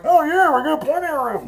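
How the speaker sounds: high-pitched mimicked call back; reenacted crew member. Plane worker answering the gate agent, high imitated call. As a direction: put on a high far-off holler. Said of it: "I'm trying to reenact it".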